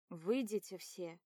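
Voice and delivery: slow, lightly voiced order; a hint of a stern doctor. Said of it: "приказывает врач".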